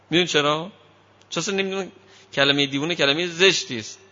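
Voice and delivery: ordinary delivery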